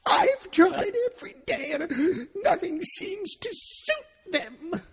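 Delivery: high, slow sobbing complaint; tearful, worn-out pigeon; with a sob. Acted. Pigeon lamenting to Alice, sobbing. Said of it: "added with a kind of sob".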